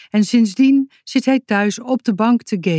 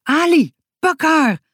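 high, urgent call; excited high-pitched yell; called command; 10 semitones higher, 4 dB louder than usual. A narrator's reading. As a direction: shout an order to the dog. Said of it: "riep Boutje".

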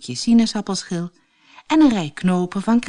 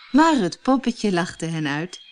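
usual speaking voice

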